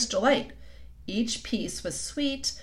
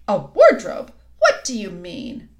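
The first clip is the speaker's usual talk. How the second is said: sharp surprised queen; 8 dB louder than usual. A narrator's reading. Queen startled.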